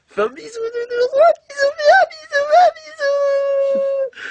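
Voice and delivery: put-on very high squeaky baby voice; baby talk. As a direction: coo at the teddy like a baby. Baby talk to teddy bear. (voix de bébé suraiguë)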